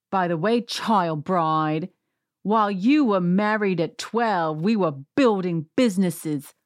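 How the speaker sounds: slow, put-on Cockney accent. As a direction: imitate her in a drawn-out mock Cockney. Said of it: "she's a Cockney".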